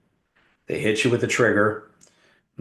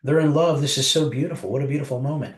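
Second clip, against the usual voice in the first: higher, sugary mock gush over the lovers; 7 semitones higher than usual. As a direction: gush like a sentimental moviegoer.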